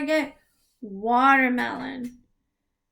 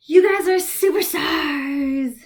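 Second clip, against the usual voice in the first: excited praise; 4 semitones higher, 7 dB louder than usual. Real, not acted. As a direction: cheer them on. Teacher praising children.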